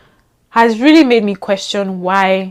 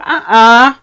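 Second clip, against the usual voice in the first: higher and louder, teasing accusation; mock call-out; 7 semitones higher, 9 dB louder than usual. Vlogger mockingly calling out a friend's fake accent, teasing call-out. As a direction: catch her out with a knowing jab.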